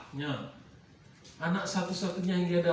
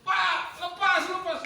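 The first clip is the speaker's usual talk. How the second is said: put-on high, loud panicked cry of a boy; frightened son crying out to his father; child screaming; 8 dB louder, slower than usual. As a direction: shriek it like a terrified child being grabbed. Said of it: "Ishak teriak-teriak".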